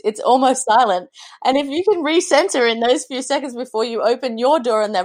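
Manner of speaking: usual speaking voice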